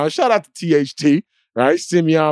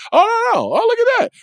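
much higher, mock surprised; mock surprise. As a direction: squeal it like a sudden discovery. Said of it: "they're like".

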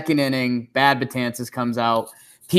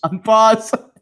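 laughing; 7 semitones higher, 5 dB louder than usual. Real, not acted. Laughing repeat of unpause.

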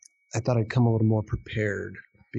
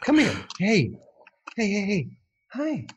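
talking to a pet; 8 semitones higher than usual. Real, not acted. Coaxing pet cat on camera.

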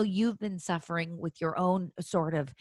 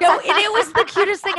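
giggling through the line, much higher and louder; delighted laughing burst; 13 semitones higher, 9 dB louder than usual. Real, not acted. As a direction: gush while laughing. Gushing over a sweet story.

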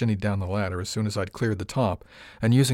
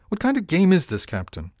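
angry demand; 9 semitones higher, 5 dB louder than usual. Acted. Dubois angry at haggling joke.

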